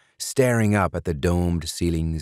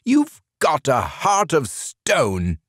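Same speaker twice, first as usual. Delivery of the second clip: higher, slow, mildly raised; hollering; 9 semitones higher, slower than usual. A narrator's reading. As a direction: throw it at him. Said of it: "Burgum hollered at him".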